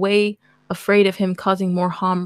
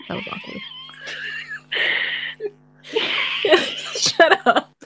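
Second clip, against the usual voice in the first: laughing. Real, not acted. Giggling at her own misreading.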